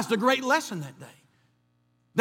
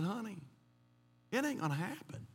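quiet resignation; 3 semitones lower, 16 dB quieter than usual. Retold or imitated. Telling his wife to give up.

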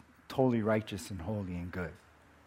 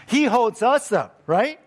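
"He holds us up" slow, higher and louder; emphatic proclaiming; 8 semitones higher, 6 dB louder than usual. Acted. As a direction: lean hard into every word of "He holds us up". Preacher stresses God's support.

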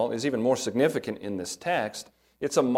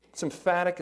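normal talking voice

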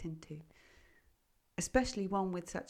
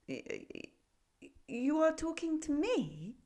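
stammering, unsure little frog; timid frog; 8 semitones higher, slower than usual. A narrator's reading. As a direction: stutter in disbelief. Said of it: "a little timidly".

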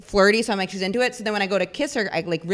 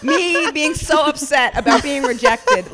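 laughing, slow and high; laughing aloud; 6 semitones higher, 4 dB louder, slower than usual. Real, not acted. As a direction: laugh through the punchline. Laughing at own story.

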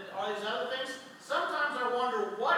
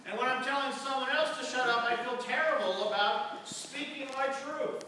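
ordinary delivery

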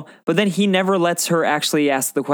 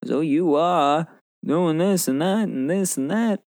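slow, drawn-out interviewer impression; Tom Hardy; 3 semitones higher, slower than usual. Podcaster mimicking the actor's interview scene, slow mock voice. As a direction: put on a slow, lilting interviewer voice. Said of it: "you have the Tom Hardy interviewing him".

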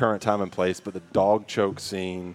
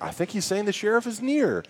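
high, mock townsfolk; lightly voiced confused townsman; quoting; 10 semitones higher than usual. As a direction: play the dim townsman figuring it out. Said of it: "they're like".

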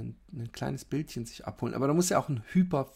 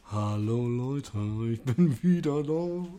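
slow announcer voice; 4 dB louder, slower than usual. Pretend. Restarting podcast intro.

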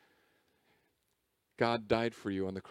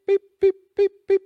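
high, loud mock beeping; imitated register beeps; scanner beeps; 17 dB louder than usual. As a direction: chirp like a checkout scanner. Mimicking checkout scanner.

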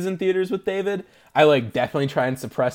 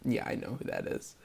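whispered aside; 4 semitones lower, 14 dB quieter than usual. Retold or imitated. Recognising actor under makeup.

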